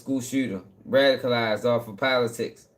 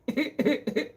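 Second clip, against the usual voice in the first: high laugh; 11 semitones higher, 5 dB quieter than usual. Real, not acted. Laughing before sign-off.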